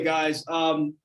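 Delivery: ordinary talk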